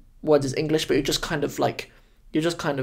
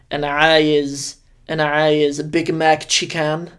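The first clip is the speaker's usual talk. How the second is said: Egyptian Arabic; 6 dB louder, much slower than usual. Retold or imitated. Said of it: "you're like".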